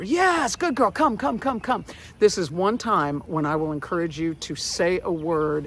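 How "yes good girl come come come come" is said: high, louder enthusiastic praise; high excited encouragement; cheerleading. Shown as a demonstration. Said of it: "you're gonna cheerlead her".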